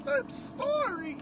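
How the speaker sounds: tiny, very high tearful squeak; squeaky crying. A narrator's reading. Andy pleads with his torturer, squeaky crying. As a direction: squeak it out slowly through tears, soft and high. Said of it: "Crying squeakily".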